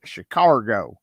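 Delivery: "Chicago" as "Sh'car-go", southern drawl; Mississippi uncles' accent. Retold or imitated. Imitating southern uncles saying the city, Mississippi accent. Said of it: "that's how they say it".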